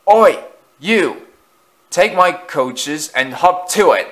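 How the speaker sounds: loud, high, far-off rough engine voice; bossy engine. A narrator's reading. Loan engine bossing the resident engines, a loud rough shout. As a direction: shout orders like a bully engine. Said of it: "one engine would say".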